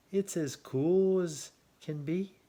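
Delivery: a trickster buzzard tempting, drawn out; sly buzzard. A narrator's reading. Buzzard luring rabbit onto his back, slow coaxing voice.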